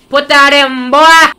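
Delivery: loud high shout; loud command. Pretend. Player yelling at the tape to go in, loud. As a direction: yell it like an order.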